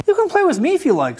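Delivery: high, quick rooster offer; a chirpy rooster offering to play. A narrator's reading. Rooster offering to play with Duck, high and quick. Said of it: "said Rooster".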